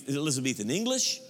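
ordinary talk, nothing marked

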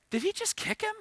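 high, startled disbelief; shocked disbelief. Retold or imitated. Coach watching a kid kick the first baseman, shocked disbelief. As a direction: gasp it out in disbelief.

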